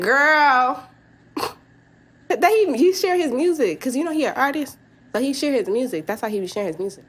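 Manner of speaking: high, sarcastic retelling of a lame excuse; mock defense. Telling her coworker the ex's excuse, mock naive. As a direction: play dumb, parroting his story.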